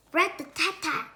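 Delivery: loud knocking rhythm; mock door banging; knocking imitation. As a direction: rap it out like a fist on wood. Knocking sound.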